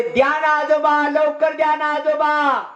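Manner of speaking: high, drawn-out begging cry; children shouting. Acted. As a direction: clamour slowly and insistently like grandchildren. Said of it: "असा आवाज जोरजोरात ओरडायला लागले मुलं".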